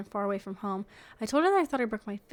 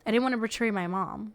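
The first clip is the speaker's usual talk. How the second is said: ordinary delivery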